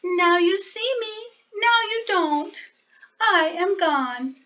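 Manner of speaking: a tiny mouse politely angling for tea; polite little mouse. Acted. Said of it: "murmured the mouse".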